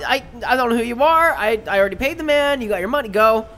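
high, loud and flustered; flustered shopkeeper. Acted. Gemini flustered, shooing the spider man and his dog. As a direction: get rid of them quickly.

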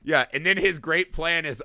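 ordinary talk, nothing marked

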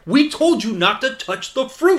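high, loud, laughing accusation; mock accusing guard. Guard accusing the couple of eating the fruit, mock outrage through laughter. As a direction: ham up a guard catching them red-handed.

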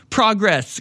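high sarcastic exclamation; hammed-up gloating; mock triumph. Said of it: "claims a member".

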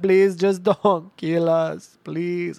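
high, drawn-out wail of begging; hammed-up tearful-style plea; panicked pleading. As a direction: imitate a terrified coworker, slowly wailing. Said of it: "Jesse's screaming".

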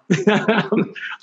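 short, high chuckle; real laugh. Real, not acted. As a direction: chuckle at your own quitting. Laughs after quoting himself quitting.

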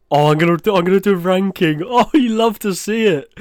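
high, loud burst of delight; gleeful excitement. Real, not acted. Host deciding to rank the food combinations, gleefully excited. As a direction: rush in gleefully, voice climbing into a laugh.

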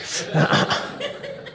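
high breathy laugh after his own joke; high breathless laugh. Real, not acted. Pastor laughs after mocking a rude post, breathless. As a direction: burst out in airy laughter.

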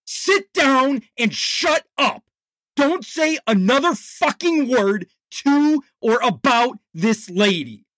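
yelling. Acted. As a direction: put him in his place. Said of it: "he yelled again".

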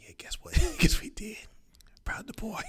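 gossipy whisper; mock secret phone call, whispered.

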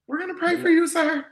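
very high put-on missionary voice; mock Jehovah's Witness. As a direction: squeak it out as a pious door-knocker. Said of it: "We Jehovah Witness".

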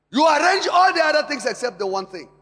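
loud, high rebuke; forceful preaching. Acted. Pastor rebuking the congregation's priorities, loud and high. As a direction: scold them from the pulpit.

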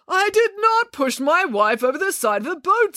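high, slow, emphatic protest; indignant denial. Acted. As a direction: deny it word by word, outraged. Accused man protests in story.

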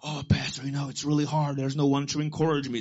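low-pitched mock complaint; discouraged complaint. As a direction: imitate a downhearted church member. Voices a discouraged church member.

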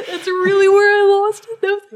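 a loud, high, tearful laugh breaking through the words; crying while laughing. Real, not acted. Recalling the game's ending to a friend, laughing through tears. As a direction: let real tears and laughter take over. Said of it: "I'm crying right now".

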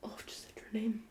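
very soft whisper on realising a slip; hushed, voiceless oops; whispered aside. Real, not acted. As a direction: whisper it like a guilty admission. Slipped an anonymous friend's name.

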